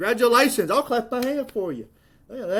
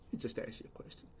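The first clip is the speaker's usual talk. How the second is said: whispered, much softer lead-in; whispered aside; 12 semitones lower, 23 dB quieter, much faster than usual. Real, not acted. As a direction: slip it out under your breath in a whisper. Lead-in said under breath, then voiced.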